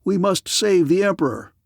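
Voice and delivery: rally the men to save him; urgent shout. A narrator's reading. Officer urging guardsmen to save the Emperor, shouting. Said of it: "shouted an officer".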